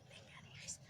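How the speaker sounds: urgent hushed whisper. Real, not acted. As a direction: whisper so nobody hears. Hiding from neighbor, whispering.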